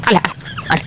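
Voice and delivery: put-on breathy whispered hush to a crying baby. Mother whispering to calm her crying baby, soft whispered hush. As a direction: whisper the hush right into the baby's ear. Hushing the baby after the drops.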